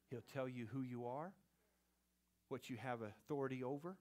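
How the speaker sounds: ordinary talk, nothing marked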